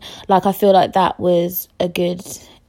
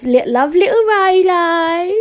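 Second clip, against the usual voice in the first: high, drawn-out, louder coo; affectionate cooing; 11 semitones higher, 5 dB louder, slower than usual. Real, not acted. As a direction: gush over a little dog in a high singsong. Cooing fondly over friend's dog.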